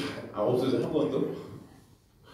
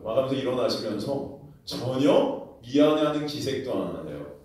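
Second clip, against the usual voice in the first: ordinary talk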